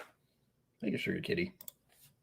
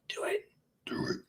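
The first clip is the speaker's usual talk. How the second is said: whisper; 11 dB quieter than usual. Real, not acted. Whispered nudge to subscribe.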